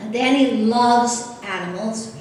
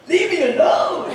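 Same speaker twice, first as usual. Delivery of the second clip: talking pig; 6 semitones higher, 5 dB louder than usual. Retold or imitated. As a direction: belt it out like a grumpy pig being whacked. Mother imitates pig in car.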